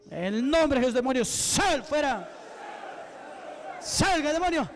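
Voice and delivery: forceful command with a stretched, rising "out"; exorcism command. Real, not acted. Preacher to a stubborn demon, commanding.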